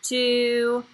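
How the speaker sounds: loud, slow counting to a class; kids show host. Shown as a demonstration. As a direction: count along slowly for young kids. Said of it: "Let's count together, everybody".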